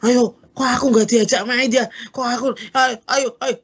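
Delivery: put-on high whining kid begging to play; whiny child. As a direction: whine like a child left out. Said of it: "Nggak usah". (rengekan anak dengan suara tinggi)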